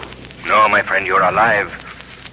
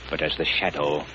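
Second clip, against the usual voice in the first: quiet menace; 8 semitones lower, 11 dB quieter than usual. Acted. Shadow, quiet and ominous.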